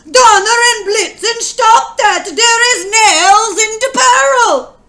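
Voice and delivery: loud, high yell of pain and alarm. Acted. Husband stuck in a barrel yells at his wife, high and loud. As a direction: bellow it as the nails dig in. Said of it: "I yelled".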